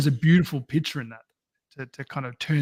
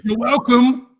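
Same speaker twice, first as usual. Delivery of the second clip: sarcastic; 8 semitones higher, 7 dB louder, slower than usual. Retold or imitated. Mocking drivers who don't wave.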